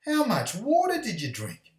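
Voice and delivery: high, loud cartoon chimp voice; loud, high chimp outburst, swooping down at the end; astonished chimpanzee. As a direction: cry it out as a flabbergasted chimp. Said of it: "the chimpanzee looks down and goes".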